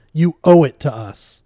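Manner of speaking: loud, insistent demand; shouted demand. A narrator's reading. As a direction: shout that it's owed. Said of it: "Julia shouted".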